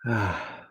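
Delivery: soft, falling "ah" sigh; sigh of relief. Shown as a demonstration. Meditation teacher showing listeners the exhale, relieved sigh. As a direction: let the breath out in relief. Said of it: "open up with that sigh of relief".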